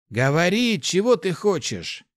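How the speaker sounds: high, loud, demanding straight out; blunt. A narrator's reading. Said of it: "спросил прямо Вильгельм". (прямой требовательный вопрос)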